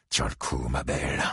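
put-on soft low murmur to the old truck. A narrator's reading. Buddy Lee murmurs to his pickup, soft and low. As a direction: coax it under his breath. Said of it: "murmura-t-il".

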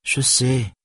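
put-on high little-girl reply, uninterested; monotone child. A narrator's reading. Daughter answering without looking up from her book, monotone. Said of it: "répondit-elle d'un ton monocorde".

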